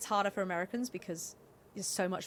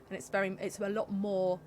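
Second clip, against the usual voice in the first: ordinary talk, nothing marked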